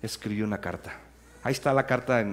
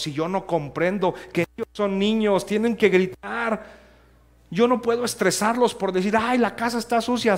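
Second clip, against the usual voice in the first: high, loud, pleading sermon voice; fervent preaching; 10 semitones higher, 7 dB louder than usual. Acted. Pastor urging parents not to stress their children, preaching loud and high. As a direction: preach it hard to the congregation.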